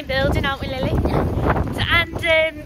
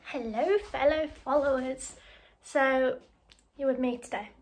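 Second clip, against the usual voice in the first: usual speaking voice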